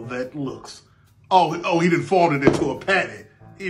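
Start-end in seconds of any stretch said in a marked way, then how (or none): none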